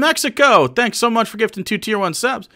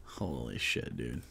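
usual speaking voice

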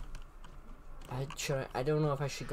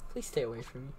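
hushed, softer plea; whispering while hiding; 4 semitones lower, 9 dB quieter, much faster than usual. Real, not acted. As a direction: beg under your breath. Hiding, begging ghost.

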